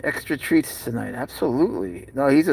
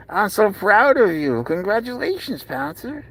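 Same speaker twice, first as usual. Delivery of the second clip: high, cooing congratulations; proud pet talk; 7 semitones higher than usual. Real, not acted. Owner proud of his cat, high cooing praise.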